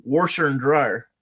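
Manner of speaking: slow "Washer" with an added r, like warsher; "Washer" said as "worsher", drawn out; Kentucky pronunciation. Shown as a demonstration. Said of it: "in Kentucky, too, I've seen".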